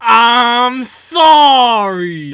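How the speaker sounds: very slow, high, loud "I'm sorry"; loud, dragged insincere apology; drawn-out sorry. Retold or imitated. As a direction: stretch out "sorry" like a lazy apology. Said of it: "So that's how he always says".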